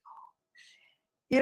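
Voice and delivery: whispered oh shit under the breath; shocked whisper. Retold or imitated. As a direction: breathe it out in stunned disbelief. Shocked aside in retold story.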